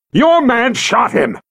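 slow, high, seething accusation; angry accusation. Acted. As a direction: spit the blame at him. Officer yanks Karg close.

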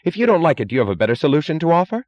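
high, loud, rapid challenge; heedless challenge. A narrator's reading. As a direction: throw the question out recklessly. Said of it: "drove him to heedlessness".

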